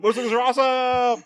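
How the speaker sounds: high, hammed-up whoop of joy; exaggerated triumphant cry; mock gleeful holler. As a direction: holler it like an overexcited action hero. Acting out imagined gleeful bike theft.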